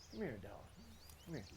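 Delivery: crouched low, soft call; soft inviting. Shown as a demonstration. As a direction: coax the dog in gently. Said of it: "That's not so intimidating".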